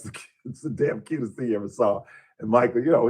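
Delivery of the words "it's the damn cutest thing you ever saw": normal talking voice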